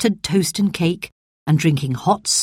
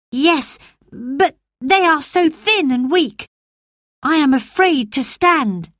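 put-on boy Colin; 9 semitones higher than usual. Colin worried.

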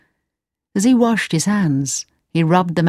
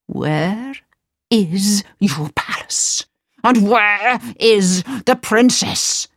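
angry; much slower than usual. Acted. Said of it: "the angry Sultan asked".

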